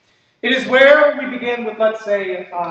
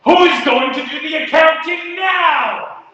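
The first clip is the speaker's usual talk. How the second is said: higher, loud, slow indignant cry; outraged shout; 8 semitones higher, 4 dB louder than usual. Acted. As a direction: wail about the loss.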